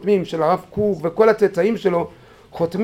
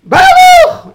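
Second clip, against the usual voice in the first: screaming the rabbi's name, much higher and louder; desperate scream; 10 dB louder, much slower than usual. Retold or imitated. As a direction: cry out for help from the cliff. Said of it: "צרחתי". (צעקה נואשת לרב מעל התהום)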